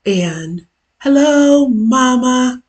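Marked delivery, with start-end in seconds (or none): none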